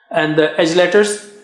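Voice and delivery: ordinary delivery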